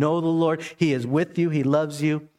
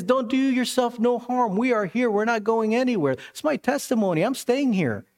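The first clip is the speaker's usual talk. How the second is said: retold yell; 9 semitones higher than usual. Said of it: "And Paul yells out".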